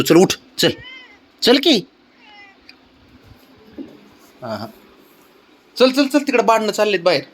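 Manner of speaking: high, eager calling to the pet; pet baby talk. Acted. Owner calling cat to come play outside, high coaxing.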